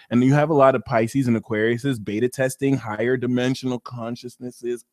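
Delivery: normal talking voice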